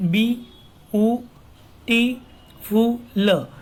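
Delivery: "B, U, T, Fu, L" in separate beats; syllable by syllable. Shown as a demonstration. Teacher recounting five syllables, each said apart. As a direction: sound out beautiful piece by piece, "B, U, T, Fu, L". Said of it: "So five syllables are there".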